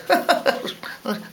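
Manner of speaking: high, acted nervous chuckle; put-on little laugh; forced laugh. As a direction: chuckle uneasily to cover doubt. Emperor's forced laugh at suit.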